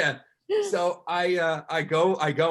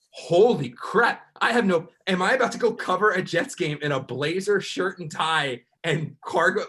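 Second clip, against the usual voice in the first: panicked disbelief; 6 semitones higher, 5 dB louder than usual. Retold or imitated. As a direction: let the panic rise as it sinks in. Panicking about missing pants.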